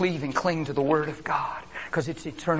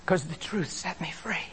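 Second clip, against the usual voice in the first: whisper; 8 dB quieter than usual. Acted. Sudden hushed testimony.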